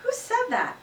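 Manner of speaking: lightly voiced strict teacher; a hint of a sharp teacher. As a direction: demand to know who spoke. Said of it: "Missus Brisbane looked around".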